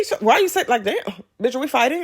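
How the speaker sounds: high-pitched, playful challenge; mock confrontation. Nail tech challenging customer's reaction, mock offended. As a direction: push the pitch right up, as if picking a fight.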